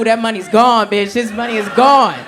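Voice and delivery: ordinary talk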